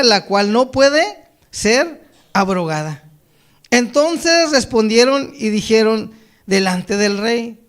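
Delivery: projected, measured Bible reading; scripture reading. Acted. Preacher reads Daniel to the congregation, solemn scripture reading. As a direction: declaim the verses phrase by phrase.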